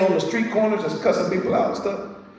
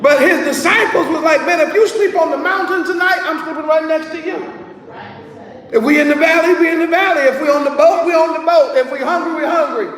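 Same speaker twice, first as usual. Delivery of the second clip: preaching at full force; 6 semitones higher than usual. Acted. Preacher to congregation, voicing the disciples' loyal vow with rising shouts. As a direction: lift the congregation with rising fervour.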